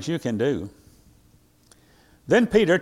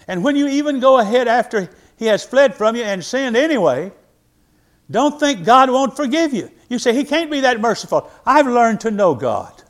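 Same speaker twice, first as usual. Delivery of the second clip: loud, higher, building preacher's cry; preaching at full force; 7 semitones higher, 6 dB louder than usual. Acted. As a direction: drive it home to the congregation.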